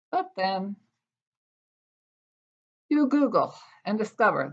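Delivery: measured, expressive poetry reading voice; wry, deliberate poem recital. Acted. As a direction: read it like a poet at a reading. Reads her poem aloud.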